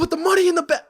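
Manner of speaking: high, loud robbery demand; bank robber. Pretend. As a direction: bark it like a robber holding up a cashier.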